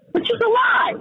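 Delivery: high, outraged exclamation; indignant outburst. Real, not acted. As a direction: burst out in high-pitched disbelief. Outraged rebuttal of police chief's claim.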